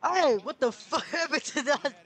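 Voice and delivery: high-pitched question broken by laughter; amused disbelief. Real, not acted. Viewer reacting to the wrecked car, laughing in disbelief. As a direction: laugh through the baffled question.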